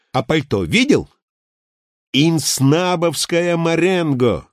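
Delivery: animated bragging that climbs in pitch; excited boasting. A narrator's reading. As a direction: show off your bargains, relishing each price. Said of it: "возбуждённо вскричал рыжий". (возбуждённое хвастовство)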